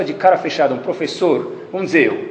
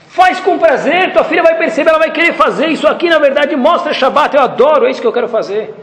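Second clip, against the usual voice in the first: enthusiastic exhortation; 11 semitones higher, 5 dB louder than usual. Real, not acted. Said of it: "preacher urging joy".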